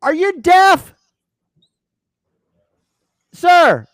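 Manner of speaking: high, loud shouted question; mock flight attendant yelling are you deaf. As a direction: bellow it slowly as if he cannot hear. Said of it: "they're yelling so loud at me".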